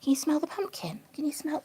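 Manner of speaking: soft unvoiced whisper to the bunny. Real, not acted. Owner whispering to her rabbit, hushed whisper. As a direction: hush it like sharing a secret.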